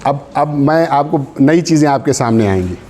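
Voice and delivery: normal talking voice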